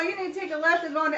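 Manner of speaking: hurried bossy passenger; quick mimicked directions. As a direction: rattle off her directions fast.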